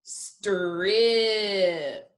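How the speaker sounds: STRIP drawn out, each sound held; slow-motion strip for sounding out; said slowly. Shown as a demonstration. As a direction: stretch strip through st, r, i to p. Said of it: "One more time".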